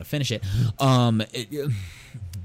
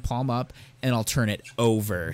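ordinary talk

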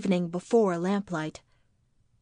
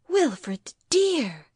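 high, louder appeal falling away; pleading wife; 7 semitones higher, 4 dB louder than usual. Acted. Wife appeals to bitter husband, pleading. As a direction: appeal to him in a higher voice.

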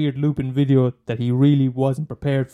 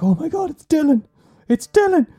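much higher, louder panicked cry; desperate friend; 5 dB louder than usual. Retold or imitated. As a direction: yell in disbelief at the zombie. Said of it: "And they're like".

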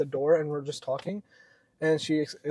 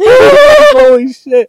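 high, loud burst of laughter; cracking up hard over the words; laughing shout; 17 dB louder, much slower than usual. Real, not acted. Laughing loudly while telling co-host about fearing arrest.

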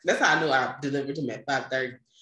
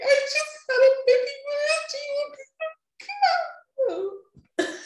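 very high, fast and loud pleading; frantic outburst; 4 dB louder, faster than usual. Retold or imitated. New mother breaking down at the pharmacy, very high frantic plea. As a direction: burst out in disbelief at the pharmacist. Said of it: "And I had a breakdown".

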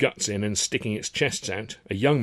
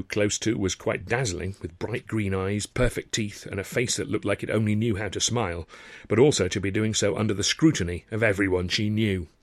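usual speaking voice

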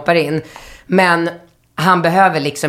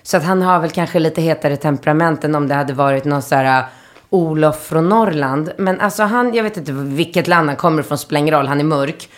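ordinary delivery

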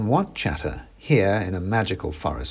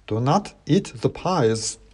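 higher, slow clacked warning; clacking wooden soldier; 4 semitones higher, slower than usual. Acted. Klaus warns the narrator not to eat, clacking. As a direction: warn in a stiff wooden clack. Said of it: "clacked a voice in my ear".

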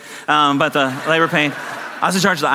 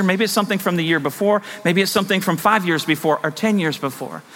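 ordinary talk

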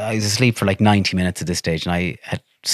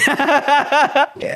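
high laugh. Real, not acted. Laugh after mock.

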